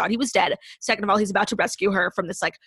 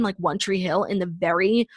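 usual speaking voice

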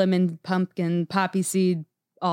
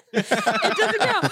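laughing squeal; much slower than usual. Acted. Laughing high mock declaration.

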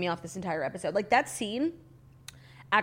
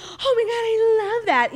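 high, loud gush of delight; over-the-top fawning quote; mock gushing; 13 semitones higher, 6 dB louder than usual. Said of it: "was like".

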